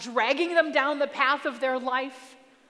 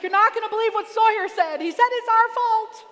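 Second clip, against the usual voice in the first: high and loud, rushing out the news; excited; 10 semitones higher, 8 dB louder than usual. Retold or imitated. Mom waking her husband, bursting with the news, high and loud.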